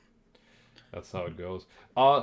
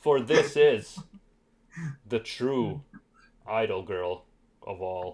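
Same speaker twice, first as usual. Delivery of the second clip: dark lord; 5 semitones lower, slower than usual. Acted. Theatrical reveal of figure.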